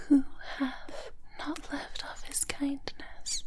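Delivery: ordinary delivery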